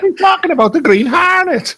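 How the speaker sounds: high-pitched mock radio excitement; hammed-up excited imitation; excited mimicry. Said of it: "He's so excited".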